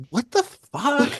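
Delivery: high, drawn-out incredulous reaction; acting out being baffled; stunned disbelief. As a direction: stretch it out in amazed disbelief. Stunned at band memorabilia everywhere.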